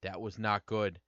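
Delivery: lightly acted telling-off, every word firm; mock talking-to. Owner grabs dog by the face to scold him, mock talking-to. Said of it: "I give him talking to".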